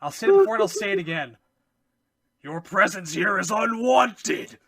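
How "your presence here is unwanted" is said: mustache twirling villain. Retold or imitated. Acted villain line quoted from game.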